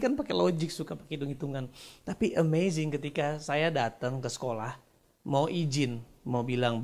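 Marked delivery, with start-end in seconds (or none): none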